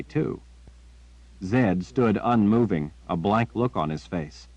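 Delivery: usual speaking voice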